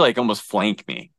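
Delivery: normal talking voice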